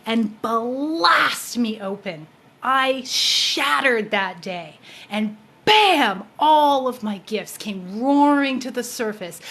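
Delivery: intense build. Real, not acted. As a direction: let it swell with awe. Climax of awakening story.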